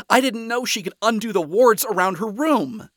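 high, loud defensive protest. A narrator's reading. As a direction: protest hotly in self-defence. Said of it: "Lucifer defended with a glare".

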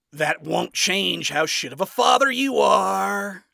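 high, loud, drawn-out jibe; scathing. A narrator's reading. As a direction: point it out with open scorn. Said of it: "Adam pointed out scathingly".

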